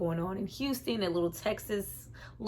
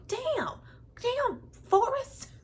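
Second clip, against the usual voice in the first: impressed damn; much slower than usual. Real, not acted. Reacting to movie character.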